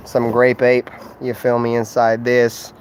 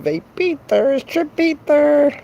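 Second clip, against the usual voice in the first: high goofy voice; 14 semitones higher, slower than usual. Acted. Odd voice about vape.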